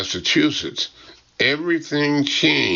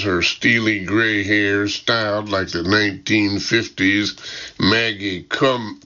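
usual speaking voice

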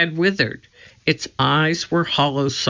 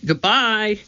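curt high goodbye; 7 semitones higher than usual. A narrator's reading. Annie leaves.

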